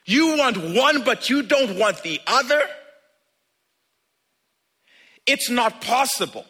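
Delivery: loud, high, impassioned sermon delivery; full-force preaching. Acted. Preacher urging the congregation about joy and sorrow, loud and fervent. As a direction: preach it out to the back row.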